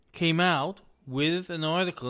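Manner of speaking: ordinary talk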